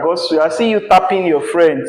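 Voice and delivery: ordinary talk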